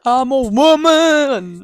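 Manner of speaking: high, hugely drawn-out WOMAN; sing-song, slow and swooping; mock-dramatic declaration. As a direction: stretch out the words in a hammy proclamation. Jokingly repeats it, drawn out.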